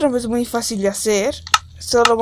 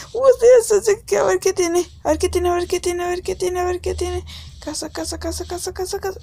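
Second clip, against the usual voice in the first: agudo y rápido, cantarín; entusiasmo agudo; 7 semitones higher than usual. Pretend. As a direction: repite ansioso por ver qué hay. Emocionado por explorar la casa. (entusiasmado por ver qué tiene la casa, agudo)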